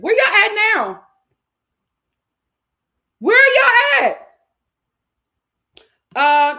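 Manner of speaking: loud, high challenging shout; calling out. Real, not acted. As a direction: holler for the missing fans, drawn out. Calling out the absent fans.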